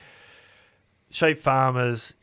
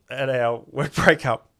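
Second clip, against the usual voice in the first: usual speaking voice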